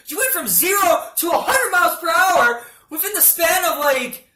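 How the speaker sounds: high, loud, frantic mock outrage; shouted squeal of flustered disbelief; outraged rant. Patient rants at the doctor's openness, high outraged shouting.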